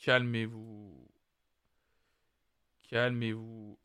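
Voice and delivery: low, soft and drawn out; slow calming hush. Real, not acted. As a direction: settle them down slowly and softly. Interrupts the stream to calm someone. (doucement, lentement, pour apaiser)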